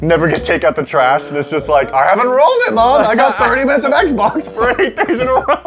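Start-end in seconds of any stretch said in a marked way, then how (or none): 1.9-5.7 put-on whiny kid. Voicing a kid pleading to mom.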